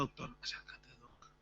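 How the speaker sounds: hushed whisper to a friend. Retold or imitated. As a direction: whisper so the radiologist won't hear. Whispering to doctor friend in exam.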